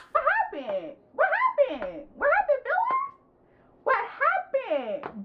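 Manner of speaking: very high, drawn-out fake concern; high, slow mocking question. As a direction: stretch it out in a teasing falsetto. Mock questioning in put-on voice.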